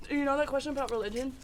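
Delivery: ordinary talk, nothing marked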